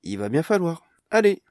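loud, high wake-up urging; Amélise rousing sleepy Barnes, loud and brisk; rousing call. Acted. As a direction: chivvy him up and out.

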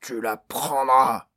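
nasty growl. A narrator's reading. Orc growling at Barnes, nasty slow growl. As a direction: drag it out like a threat. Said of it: "gronda l'orque d'un ton mauvais".

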